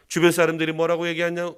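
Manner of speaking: ordinary delivery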